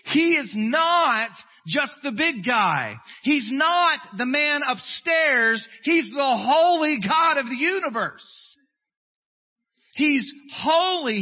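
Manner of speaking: loud, high, slow pulpit proclamation; full-force preaching. Acted. Preacher booming to the congregation.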